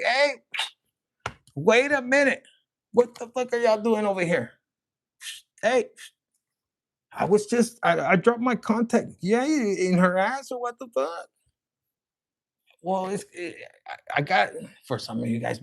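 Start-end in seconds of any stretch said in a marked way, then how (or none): 0.0-9.1 angel voice. Acted. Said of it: "even the angel's like". 9.2-11.3 mock jab. Mocking remark, put-on voice.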